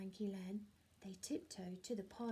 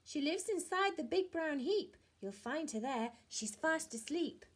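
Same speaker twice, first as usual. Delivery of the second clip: high, louder hen voice; helpful hen voice; 6 semitones higher, 4 dB louder than usual. Acted. Hen directing the thieves to the heap, high. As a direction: point the way brightly.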